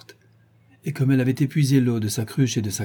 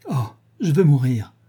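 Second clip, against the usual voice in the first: a raised, despairing outcry, moderately louder; anguished cry; 3 semitones higher, 4 dB louder than usual. A narrator's reading. Catherine to Nelly, wanting to die, anguished cry. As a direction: cry out that you want to die. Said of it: "s'écria-t-elle".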